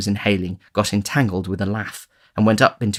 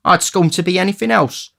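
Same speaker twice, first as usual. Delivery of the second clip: scornful boast; 10 semitones higher, 4 dB louder than usual. A narrator's reading.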